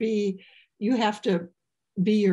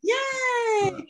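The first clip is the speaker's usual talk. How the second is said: much higher gleeful cheer; cheering yay; 12 semitones higher than usual. Real, not acted. Singer cheers at the end of her song, much higher. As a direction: cheer to the crowd.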